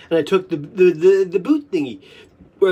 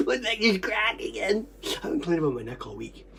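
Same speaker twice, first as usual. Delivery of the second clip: ordinary talk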